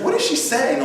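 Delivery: high, puzzled what-is-she-saying; bewildered husband asking; baffled question. Retold or imitated. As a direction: ask it confused and high-pitched. Husband baffled by screaming wife.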